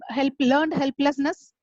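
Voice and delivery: ordinary talk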